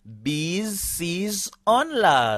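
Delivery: slow, word-by-word list for repeating; measured language-teacher drill; slow model pronunciation. Shown as a demonstration. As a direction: say each pronoun slowly, spaced out for learners. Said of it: "Répétez avec moi".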